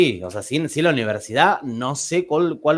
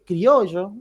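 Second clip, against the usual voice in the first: a light Córdoba lilt on "Criollo", a bit higher; Córdoba tonada; 5 semitones higher than usual. Retold or imitated. Baker correcting the customer, in a light Córdoba tonada. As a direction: mimic the baker's Córdoba sing-song on "Criollo". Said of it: "me lo dijo con la tonada".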